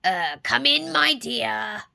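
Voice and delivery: wolf faking granny, scratchy; deep scratchy voice. A narrator's reading. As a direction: croak it like a fake granny. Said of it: "deep, scratchy voice that answered".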